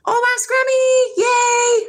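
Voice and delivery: high, slow, loud delighted cry; excited greeting. Real, not acted. Streamer greets a returning viewer with a loud, drawn-out delighted cry. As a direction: stretch it out with delight at a friend's return.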